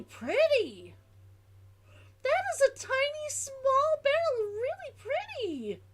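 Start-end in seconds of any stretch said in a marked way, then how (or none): 2.2-5.8 put-on much higher, sing-song baby talk; cutesy high voice. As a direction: coo it like talking to a kitten. Repeats line in cutesy voice.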